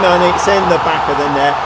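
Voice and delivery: loud, fast goal call; excited shout. Real, not acted. Player calling a goal from a corner, excited shout. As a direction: shout as the ball goes in.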